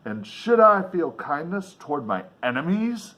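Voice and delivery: ordinary delivery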